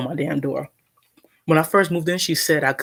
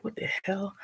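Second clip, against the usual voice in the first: whispered disbelief; 5 semitones higher, 10 dB quieter than usual. Retold or imitated. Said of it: "He was just like".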